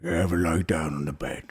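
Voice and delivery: a lightly voiced big brute; half-giant voice. Half-giant attendant asking the patient, low and soft. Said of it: "he looks over to you and goes".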